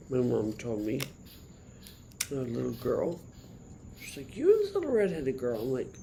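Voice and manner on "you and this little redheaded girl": higher, louder, quick quoted voice; mock mom, pitched up and rushed; imitating mom. Retelling what her mom said.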